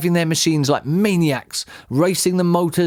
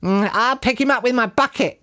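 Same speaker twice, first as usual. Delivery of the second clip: high, odd little character shout; 10 semitones higher than usual. A narrator's reading. Bunce boasts from his tractor, shouting. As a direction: boast in a pitched-up farmer's holler. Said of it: "shouted Bunce".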